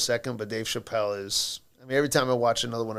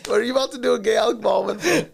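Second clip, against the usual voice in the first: higher, louder, laughing shout of disbelief; 9 semitones higher, 4 dB louder, faster than usual. Real, not acted. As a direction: yell it while cracking up. Cracking up accusing friend.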